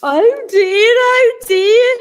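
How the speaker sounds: high, sing-song worried lament, not muttered; a lightly voiced fretting bear; muttering. A narrator's reading.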